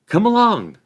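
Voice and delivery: put-on loud, high call of a young hobbit; Merry, brisk. A narrator's reading. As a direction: call out briskly to an old acquaintance.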